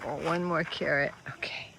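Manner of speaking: normal talking voice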